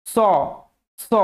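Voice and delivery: স said as a sharp s with tongue at the teeth; dental s sound demo. Shown as a demonstration. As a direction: hiss স like a snake. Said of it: "দন্তের স উচ্চারণ করেন". (দাঁতে জিভ রেখে স)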